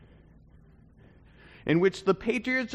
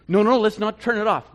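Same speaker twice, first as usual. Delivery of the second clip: loud, raised insistence; urgent plea; 5 semitones higher, 8 dB louder than usual. Pretend. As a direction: stop them switching it off. Urging fans at party.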